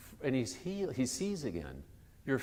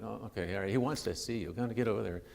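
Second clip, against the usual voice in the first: low, softened, grudging go-ahead; changed tune; 4 semitones lower, 6 dB quieter, faster than usual. Retold or imitated. Crowd relents and sends beggar to Jesus, subdued. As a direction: back down quietly, voice dropped. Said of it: "they've sort of changed the tune".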